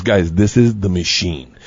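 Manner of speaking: slow, low-pitched Igor voice; imitated slow, solemn mobster; gangster introduction. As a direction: present him gravely, unhurried.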